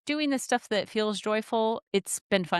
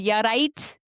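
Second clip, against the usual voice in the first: Scouse accent; 4 semitones higher, 3 dB louder than usual. Shown as a demonstration. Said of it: "They have that fricative".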